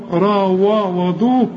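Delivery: "را و وضو" drawn out with dark vowels; slowly over-thicken "وضو"; wrong heavy dal. Shown as a demonstration. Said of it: "مش را وضو".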